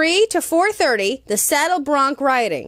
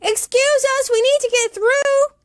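put-on much higher little girl voice; Katie's voice; 12 semitones higher than usual. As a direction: play Katie, politely pleading. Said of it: "said Katie".

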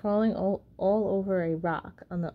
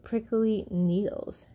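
usual speaking voice

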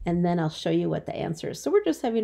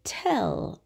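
whispered Tell; whispered target word. Shown as a demonstration. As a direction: breathe Tell. with no voice. Teacher repeats word for listening.

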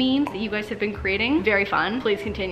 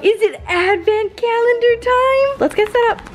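high, drawn-out squeal to a pet; excited dog talk; 11 semitones higher, 4 dB louder than usual. Real, not acted. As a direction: hype the dog up, very high and stretched out. Hyping up her dog for the calendar.

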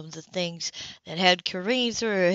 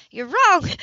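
loud, high cry of protest; outburst; 10 semitones higher, 9 dB louder than usual. A narrator's reading. Grace contradicts the abusive mother on the phone, loud outburst. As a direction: burst out with a sharp, raised contradiction. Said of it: "Grace heard herself say".

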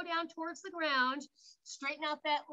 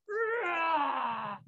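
drawn-out imitation roar, falling at the end; lion roar; 3 semitones higher than usual. Shown as a demonstration. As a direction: give a long big-cat roar. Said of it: "I can hear Kay".

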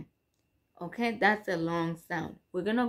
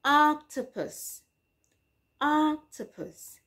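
slow demo word; 8 semitones higher than usual. Shown as a demonstration. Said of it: "as in".

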